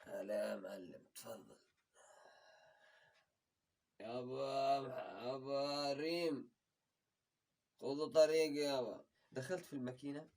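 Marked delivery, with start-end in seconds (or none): 0.0-1.6 homeowner welcome. Retold or imitated. 4.0-9.1 put-on father calling kids.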